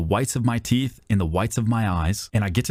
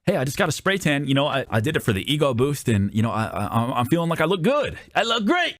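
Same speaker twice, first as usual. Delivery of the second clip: self-pleased; 5 semitones higher than usual. Acted. Spray-tanned customer boasting of looking good, self-pleased. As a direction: pitch climbing into giddy pride. Acting a proudly tanned person.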